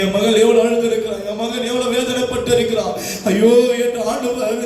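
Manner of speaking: usual speaking voice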